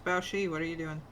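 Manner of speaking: ordinary talk, nothing marked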